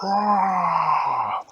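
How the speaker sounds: long, loud, high monster roar; Hulk roar. Acted. Scientist has turned into the Hulk, monster roar. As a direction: roar it out, letting the pitch slide down. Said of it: "and he was like".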